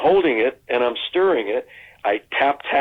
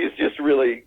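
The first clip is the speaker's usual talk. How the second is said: usual speaking voice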